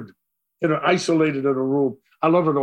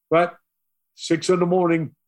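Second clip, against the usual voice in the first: ordinary delivery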